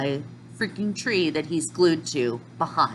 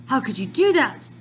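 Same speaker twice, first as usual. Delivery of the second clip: higher, louder outraged accusation; mock angry cry; 6 semitones higher, 3 dB louder than usual. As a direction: hurl it at the attacker. Shiki angry at the attacker.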